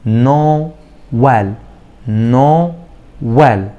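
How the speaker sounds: نو rising, then ويل after a break; split syllables. Shown as a demonstration. Teacher drilling students on the break in نو ويل. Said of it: "وقفة إيه؟ صوتية".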